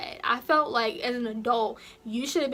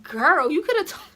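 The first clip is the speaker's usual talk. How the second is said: sassy comeback; 2 semitones higher, 6 dB louder than usual. Retold or imitated.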